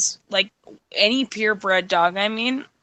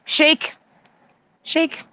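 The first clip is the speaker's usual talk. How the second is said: high, loud dog commands; commanding the dog; 7 semitones higher, 4 dB louder, slower than usual. Real, not acted. Owner ordering the dog to shake, high and loud. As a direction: bark out the trick command, up high.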